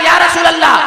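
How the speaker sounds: call-and-response cry for everyone to join; rousing call to the crowd. Shown as a demonstration. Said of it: "सारे लोग".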